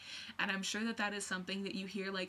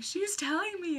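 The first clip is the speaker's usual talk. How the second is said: retold fangirl thought, higher and louder; starstruck thought; 10 semitones higher, 5 dB louder than usual. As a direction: gush it like a starstruck fan. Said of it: "and I was like".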